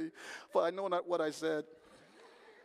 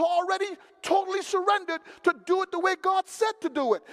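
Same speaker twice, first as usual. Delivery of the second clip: loud, higher driving sermon voice; a fired-up preacher at the pulpit; full-force preaching; 5 semitones higher, 8 dB louder than usual. Acted. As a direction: preach it hard, rising and pounding.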